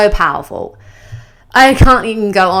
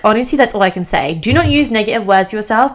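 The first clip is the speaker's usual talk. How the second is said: normal talking voice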